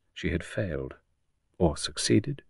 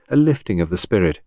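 plain reading voice

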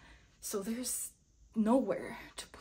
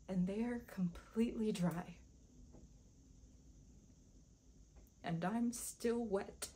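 melancholy; 8 dB quieter than usual. Acted. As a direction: let it sink, barely above a whisper. Punchline about still suffering.

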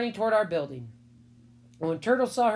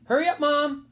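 high, louder call to Mom; raised, hurrying call; 8 semitones higher, 6 dB louder than usual. A narrator's reading. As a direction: call her urgently from another room. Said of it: "I called".

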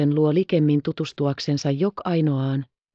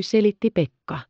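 ordinary delivery, nothing marked

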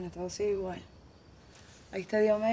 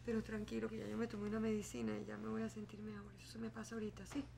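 quiet, teary voice held back; hiding tears; 10 dB quieter than usual. Acted. Mother hides her tears from her young son, quiet and teary. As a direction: soften it so the child won't notice the crying. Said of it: "¿Por qué estás llorando?".

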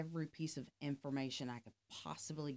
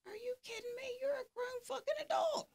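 much higher, mock-incredulous outburst; exasperated disbelief. Retelling his first thought about the employee, in high mock exasperation. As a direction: throw it up high like you can't believe it.

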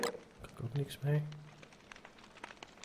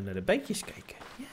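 ordinary talk, nothing marked

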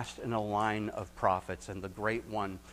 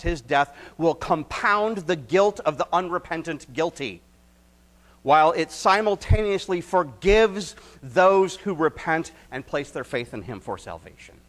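much higher and louder, soaring sermon peak; preaching at full force; 8 semitones higher, 14 dB louder than usual. Acted. Pastor to church on Jesus' death, full-force preaching. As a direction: proclaim it with all your might.

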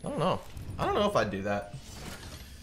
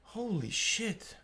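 jump scared; 10 semitones higher, 7 dB quieter, slower than usual. Real, not acted. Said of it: "Actually fucking jump scared me".

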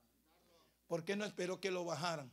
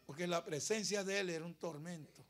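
ordinary delivery

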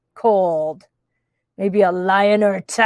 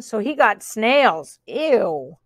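usual speaking voice

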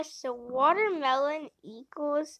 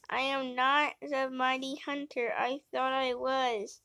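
ordinary delivery

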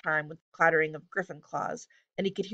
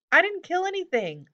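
high, indignant squawky denial; louder, pitch jumping way up in protest; protesting hobgoblin; 12 semitones higher, 5 dB louder than usual. A narrator's reading. Hobgoblin denying Jared's accusation, indignant protest.

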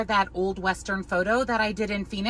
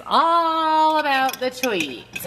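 slow, higher, stretched all about the treats; drawn-out sing-song; 6 semitones higher, much slower than usual. Real, not acted. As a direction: drag it out teasingly to the dog.

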